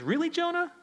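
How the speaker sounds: leaping high, disbelieving question; incredulous God. Acted. God questioning Jonah's anger, high incredulous really. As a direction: raise the voice in mock disbelief.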